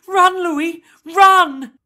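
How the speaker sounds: high, very loud scream of warning; urgent yell. A narrator's reading. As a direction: shout it out in alarm. Girl yells warning at captor's sight.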